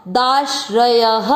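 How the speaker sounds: दाश्रयः slowly, ending on a breathy ह for the visarga; careful Sanskrit pronunciation; slow demo. Shown as a demonstration. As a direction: draw out दाश्रयः with a final ह. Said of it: "विसर्ग को पढ़ेंगे".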